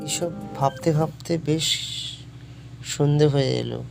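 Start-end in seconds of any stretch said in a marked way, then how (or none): none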